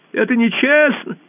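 high squeaky pleading voice; ball begging the emperor for its family, squeaking. Acted. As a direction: squeak and beg, small and desperate. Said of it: "запищал мяч".

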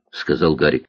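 ordinary delivery

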